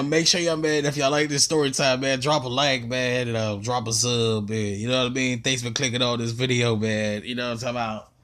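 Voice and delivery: ordinary talk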